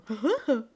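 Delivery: loud put-on chuckle; short performed laugh; a showy laugh for a fan; laugh on request. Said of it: "This one's just for you".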